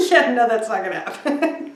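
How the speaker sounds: lightly voiced client laughing it off; a hint of amused dismissal; laughing refusal. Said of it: "She was like".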